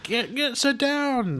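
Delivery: high-pitched, flustered mock order; flustered dad. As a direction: imitate a dad struggling to restrain his son. Dad restraining panicking son, retold.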